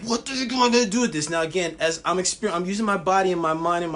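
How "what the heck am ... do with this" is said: exasperated. Retold or imitated. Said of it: "And I'm like".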